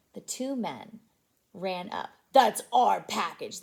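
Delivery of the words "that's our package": gruff crooks. Acted. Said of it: "they said".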